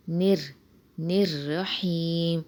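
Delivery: رحیم with a light, thin ra. Shown as a demonstration. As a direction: keep the ra in نر thin. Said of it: "را کو باریک پڑھیں گے".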